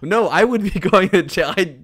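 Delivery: high, loud, cracking up; helpless laughing objection; laughing protest. Real, not acted. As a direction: protest loudly through laughter.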